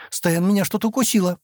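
mildly alarmed, high-pitched cry; frightened exclamation. A narrator's reading. Girl tells Стэн something bit her, mildly frightened. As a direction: raise the pitch, a touch of fright. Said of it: "испуганно воскликнула она".

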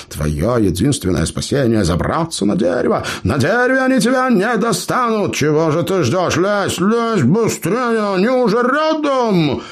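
high, urgent panicky urging; frantic urging. Acted. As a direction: shout him up the tree in panic. Panicking dwarf urging climb.

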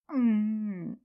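shaky tearful mumble through closed lips; whimpering hum; trembling hum. A narrator's reading. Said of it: "невнятно промычала женщина".

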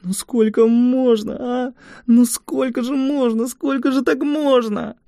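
high, slow, tearful lament; tearful pleading. Acted. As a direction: sob it out in despair. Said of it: "хлюпая носом, она снова и снова шептала".

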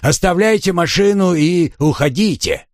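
high mocking bandit; scornful thug. A narrator's reading. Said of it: "засмеялся бандит".

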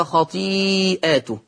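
خطيئاته read as a plural, long ī stretched, then long ā after the hamza. Shown as a demonstration. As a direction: hold the ī of خطيئاته long, then open to "ātuhu". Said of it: "قرأ ورش بالجمع هكذا". (خطيئاته بالجمع مع مدّ الياء)

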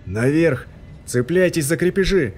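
shouted command. A narrator's reading. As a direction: bark the order. Commanding escape.

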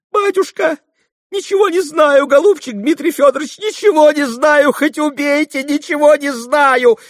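very high, loud frantic denial; terrified swearing. A narrator's reading. Fenya swearing to Mitya she knows nothing, terrified protest. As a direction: swear innocence in panicked high pitch. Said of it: "заклялась, забожилась Феня".

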